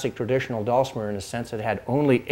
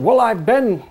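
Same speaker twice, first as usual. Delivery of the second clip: put-on higher, louder stab at a German accent; attempted German accent; 10 semitones higher, 6 dB louder than usual. Violin maker answering a young customer, attempted German accent. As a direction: imitate a German craftsman, accent half-managed. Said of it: "I can't do my German accent".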